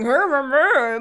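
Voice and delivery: loud mock "hur, hur, hur" growl; mocking gibberish imitation. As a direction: caricature a bad accent as wordless noise. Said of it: "they just sounded like they're going".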